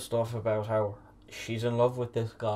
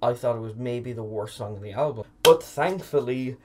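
ordinary delivery

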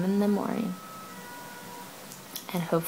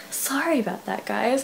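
ordinary talk, nothing marked

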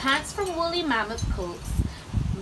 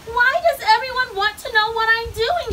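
high, loud outburst; exasperated shout; 11 semitones higher, 8 dB louder than usual. A narrator's reading. Grumpy Bird fed up with questions, shouting. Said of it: "shouted Bird".

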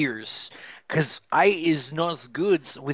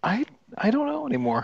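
exasperated squeak; 11 semitones higher, faster than usual. Acted. Exasperated at the grammar.

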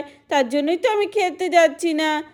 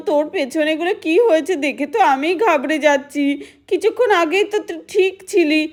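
ordinary talk

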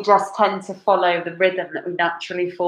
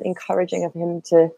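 ordinary talk, nothing marked

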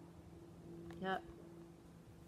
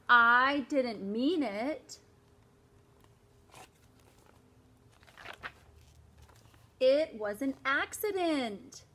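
slow, louder whiny excuse; pleading kid; 2 semitones higher, 5 dB louder, slower than usual. Acted. David excusing himself to mom, slow and whiny. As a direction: beg to be let off.

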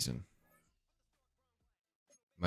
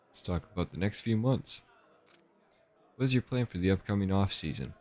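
ordinary delivery

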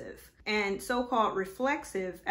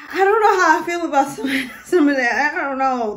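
laughing through the words; giggly, amused disbelief; 6 semitones higher, 15 dB louder than usual. Real, not acted. Laughing at the examples.

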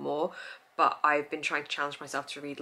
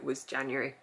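ordinary talk

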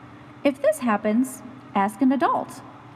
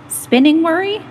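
louder, higher question; a loud, bright announcing voice; calling out; 4 semitones higher, 8 dB louder than usual. Acted. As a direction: announce it loudly like a quiz host.

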